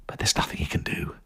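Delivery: ordinary delivery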